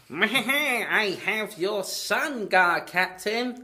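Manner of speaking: high, loud, slow villain taunt; cackling cartoon kidnapper; hammed-up villain. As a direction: gloat theatrically over your hostage. Acts out villain holding a child hostage.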